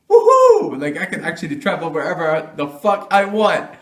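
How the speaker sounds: loud high whoop, laughing; excited whoop. Pretend. Imagining holding an American passport, loud excited whoop. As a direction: cheer like you just got the passport. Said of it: "I'd be like".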